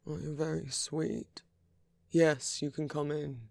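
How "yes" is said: sudden loud call. Acted. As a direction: call it so they hear outside. Calling to visitor through door.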